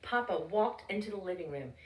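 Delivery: usual speaking voice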